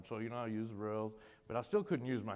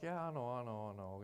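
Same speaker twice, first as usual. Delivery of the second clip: softer, trailing half-hearted agreement; 5 dB quieter than usual. Retold or imitated. Patient brushing off a plea to try, half-hearted. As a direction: agree without really meaning it. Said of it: "kinda half-hearted about it".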